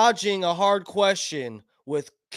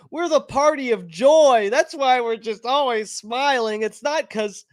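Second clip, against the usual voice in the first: mock Harris; 4 semitones higher than usual. Mocking Harris's cheerful answers.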